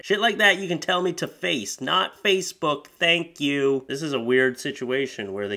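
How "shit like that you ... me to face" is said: normal talking voice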